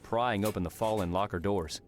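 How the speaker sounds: ordinary delivery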